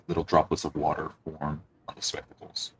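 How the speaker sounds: ordinary talk, nothing marked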